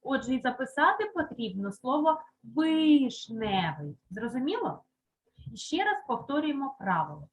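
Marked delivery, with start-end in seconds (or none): 2.4-4.0 вишневий slowly, with a distinct «и»; clear Y spelling. Shown as a demonstration. Teacher gives pupils the spelling, slow вишневий with clear «и». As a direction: draw out вишневий, first vowel clearly «и». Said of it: "в наголошеному складі буква".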